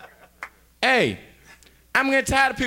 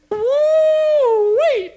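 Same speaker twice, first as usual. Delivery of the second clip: much higher, louder whoop; wild joyful whoop; excited whoop; 7 dB louder than usual. Acted. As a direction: holler with delight at the drink. After drink in poem.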